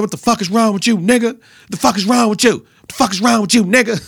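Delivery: a heated imitated shout; Bobby Portis mock yell. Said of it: "He was saying".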